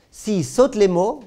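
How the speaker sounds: insistent slow. Shown as a demonstration. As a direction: drag it out to drive the point home. Répète la règle lentement, avec insistance. (lent et appuyé sur « saute »)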